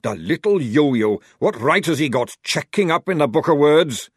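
loud, indignant rant; angry rant. Acted. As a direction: rant about the officer. Sergeant ranting about the officer.

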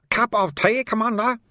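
bright, high friendly offer; cheerful. Acted. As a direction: offer the tea gladly.

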